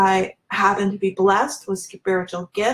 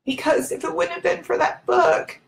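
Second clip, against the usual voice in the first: real tearful voice, pitched high; fighting back tears as the voice rises; choked up; 7 semitones higher than usual. Real, not acted. Woman telling viewers about the book that changed her life, choked up. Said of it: "it's making me emotional thinking about it".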